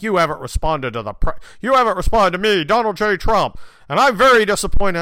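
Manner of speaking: hammed-up wounded demand; mocking Trump text. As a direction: read the text like a pouting, loud scold. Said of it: "there was some, like".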